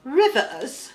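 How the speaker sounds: "Rivers" said like the Queen in a British accent, mouth barely open; a posh British accent on "Rivers"; British Queen accent, "Rivers" said with a tight mouth; mock British Queen. Shown as a demonstration. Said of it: "if you feel like the Queen".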